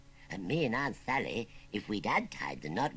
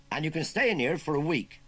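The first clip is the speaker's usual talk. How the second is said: lightly voiced farmer; 8 semitones higher, 6 dB louder than usual.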